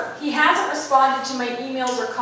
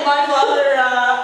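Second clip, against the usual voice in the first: ordinary talk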